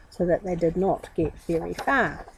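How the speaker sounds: ordinary delivery, nothing marked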